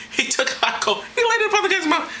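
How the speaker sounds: high-pitched, laughing disbelief; squeaky amused outburst into laughter. Real, not acted. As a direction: crack up while saying it. Cracks up at the hot coal.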